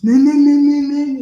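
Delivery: loud mocking moo moo; toothless moo. Shown as a demonstration. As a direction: moo loudly like a toothless dog. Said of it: "walking around like this".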